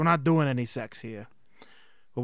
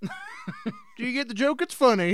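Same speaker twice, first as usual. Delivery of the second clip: ordinary delivery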